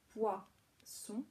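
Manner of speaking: POISSON said slowly in two separate syllables; split syllables. Shown as a demonstration. Teacher showing parents the syllables of poisson, slowly split. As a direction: break poisson into pois, then son. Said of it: "que la première syllabe".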